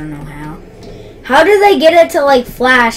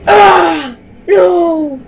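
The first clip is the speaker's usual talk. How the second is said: despairing shout; 5 semitones higher, much slower than usual. Real, not acted. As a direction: cry out as it goes in. Despairing at goal conceded.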